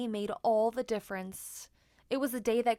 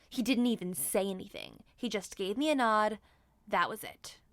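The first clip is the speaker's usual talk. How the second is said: ordinary delivery, nothing marked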